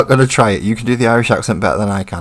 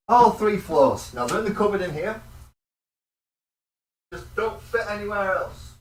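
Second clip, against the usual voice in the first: higher, softer voice from off in a cupboard; away from the mic; 9 semitones higher, 12 dB quieter than usual. Real, not acted. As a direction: call it out faintly while rummaging away from the mic. Off rummaging in the cupboard.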